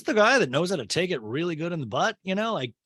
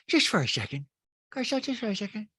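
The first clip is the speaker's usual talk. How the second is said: put-on Rinna, rising higher; Rinna, soothing; 8 semitones higher, faster than usual. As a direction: coax her to pause. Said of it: "Rinna goes".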